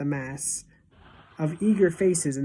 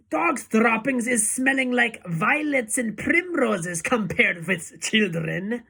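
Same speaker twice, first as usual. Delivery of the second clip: ranting witch; 7 semitones higher, 5 dB louder than usual. A narrator's reading. Witch leader reviling children, high ranting voice. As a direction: rant it high, drawing out the end.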